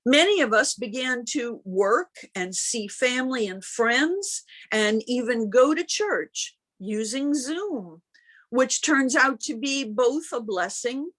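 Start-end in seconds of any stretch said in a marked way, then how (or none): none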